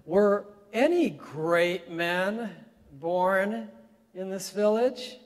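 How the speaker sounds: slow, high, belittling question; loud, slow sneer of a question; condescending. Retold or imitated. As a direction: talk down to the old man, stretching great men. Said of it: "in a very condescending and very belittling way".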